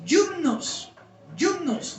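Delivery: "yumnos" opening with a "yu" sound; Greek pronunciation. Shown as a demonstration. Preacher teaching the Greek word for hymns, "yumnos" pronounced with "yu". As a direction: say "yumnos" slowly and separately as a Greek term. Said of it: "el término griego que aparece en la Biblia es el siguiente".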